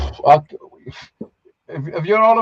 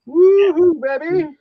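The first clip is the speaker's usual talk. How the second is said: excited whoop; 9 semitones higher, 5 dB louder, much slower than usual. Real, not acted. Cheering out loud in chat.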